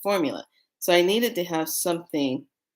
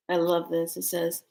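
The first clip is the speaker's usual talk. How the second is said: usual speaking voice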